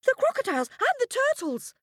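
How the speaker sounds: shouting crowd. A narrator's reading. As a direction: blurt it out all at once. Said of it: "shouted all the animals".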